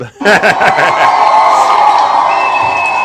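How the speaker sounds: big loud laugh; loud burst of laughter. Real, not acted. Chat joke about Deb.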